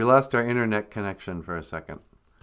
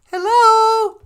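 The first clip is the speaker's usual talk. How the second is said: grandma voice; 9 dB louder than usual. Acted. Grandmother voice answers the call.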